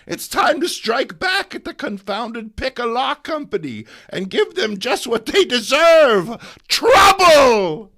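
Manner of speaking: put-on high, loud crusading old lady; high old woman rallying for revolt; rallying granny. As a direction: whip up the crowd like a rebel granny. May rallies a crusade.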